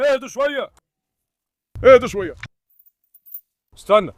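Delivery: loud command to settle down; loud calm-down order to dogs. Real, not acted.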